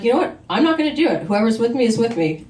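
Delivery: rally the class and refuse loudly; angry outburst. Retold or imitated. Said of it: "I was getting really pissed off at this".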